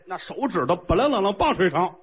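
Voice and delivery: ordinary talk, nothing marked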